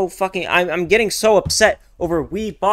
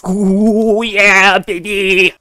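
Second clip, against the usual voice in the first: loud, higher triumphant yell; victory whoop; 4 semitones higher, 11 dB louder, much slower than usual. Real, not acted. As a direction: crow over the win. Celebrating a knockout win.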